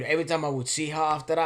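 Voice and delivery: usual speaking voice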